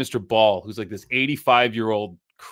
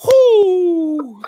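loud, high drawn-out whoop; imitated shouted "whoo"; coach's whoop; 7 dB louder than usual. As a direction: whoop high and long, mimicking the old coach. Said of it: "And the guy just goes".

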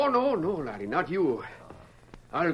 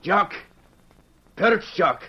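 a falconer's sharp call; calling the falcon; 5 semitones higher, 4 dB louder than usual. Acted. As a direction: call out louder to the bird.

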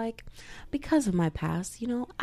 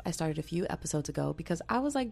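normal talking voice

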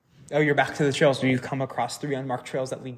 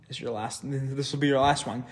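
usual speaking voice

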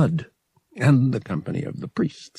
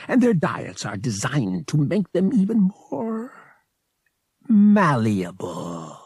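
alien priest voice; 8 semitones higher than usual. Acted. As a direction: play a smug alien priest. Verotil explains pilgrims.

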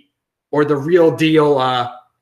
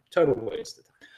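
ordinary talk

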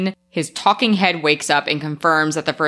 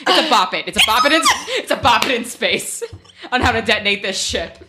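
high-pitched, giggly riffing; laughing; 6 semitones higher than usual. Real, not acted. Joking to co-host about detonation controls, laughing, high. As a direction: say it through real laughter, high.

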